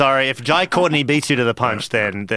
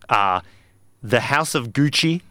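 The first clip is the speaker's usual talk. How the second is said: ordinary talk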